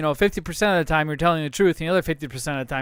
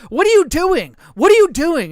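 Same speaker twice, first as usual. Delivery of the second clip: high, loud alarmed wife in the background; imitating the wife's high, loud cry; wife yelling; 11 semitones higher, 7 dB louder than usual. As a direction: call out sharply from across the room.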